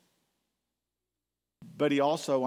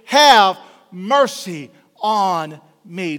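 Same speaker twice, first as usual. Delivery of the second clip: slow, loud, stretched-out plea; crying out for mercy; 3 semitones higher, 14 dB louder, much slower than usual. Retold or imitated. Beggar crying out to Jesus for mercy, slow and loud. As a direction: draw out each word as a cry for mercy. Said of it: "I'm calling out unto you".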